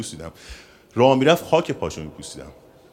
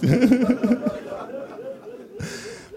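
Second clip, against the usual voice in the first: high laugh. Real, not acted. Speaker to audience after fond praise, high laughter.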